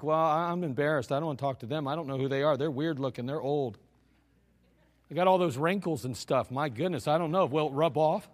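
low, muted imitation of a reluctant teen; mock embarrassed youngster. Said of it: "you're gonna act like".

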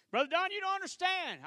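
loud, high protest in a doubter's voice; preached doubter's cry. Acted.

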